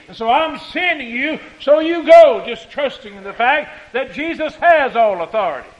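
forceful pulpit recitation with loud peaks; preaching scripture. Real, not acted. Preacher quoting Jesus sending out disciples, proclaiming. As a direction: proclaim it to the congregation.

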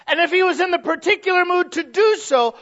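high, loud shouted preaching; preaching at full force. Acted. Preacher driving home David's excess, shouting. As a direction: keep the shout going.

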